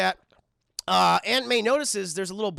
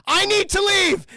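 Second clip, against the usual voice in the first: much higher, louder sudden line; abrupt exit; 10 semitones higher, 8 dB louder than usual. Retold or imitated. Norman realizing the truth, louder mock panic.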